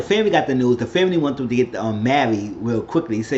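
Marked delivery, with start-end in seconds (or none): none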